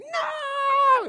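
high, loud shriek of protest; child's shout. Retold or imitated. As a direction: scream no like a scared kid. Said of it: "she's like".

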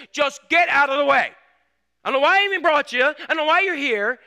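put-on loud, high scolding of a child; angry dad yelling. Father scolding his young son at the landfill, loud angry yelling. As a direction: snap at the kid like an exasperated father. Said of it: "and I hear this".

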